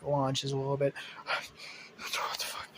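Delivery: ordinary talk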